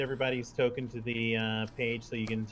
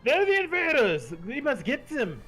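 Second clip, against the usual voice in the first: high, loud alarmed battle cry; shout; 13 semitones higher, 7 dB louder than usual. A narrator's reading. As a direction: shout it like rallying the guards. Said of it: "Louis Suarez shouts".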